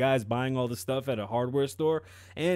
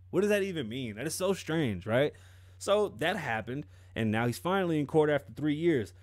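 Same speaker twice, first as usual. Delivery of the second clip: normal talking voice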